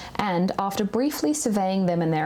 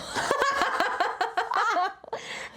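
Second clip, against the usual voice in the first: high, helpless cracking-up laugh; burst of laughter; 13 semitones higher, 3 dB quieter than usual. Real, not acted. Reader cracking up at the savage line, real laughter.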